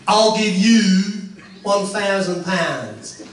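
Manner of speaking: ordinary delivery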